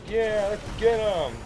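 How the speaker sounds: high, whooping battle cry; rally the team with a high whoop; hyped cheer. Retold or imitated. Two players charging in, whooping high and excited. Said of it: "we're both like".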